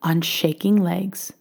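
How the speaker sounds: usual speaking voice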